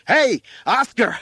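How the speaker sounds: slow, high, very loud call; winded fireman's yell. A narrator's reading. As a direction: holler up to catch his attention. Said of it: "the winded fireman yelled".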